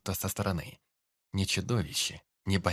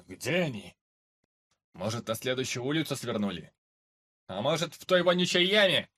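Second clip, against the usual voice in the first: soldier voice; 4 semitones higher than usual. Acted. Soldiers searching above.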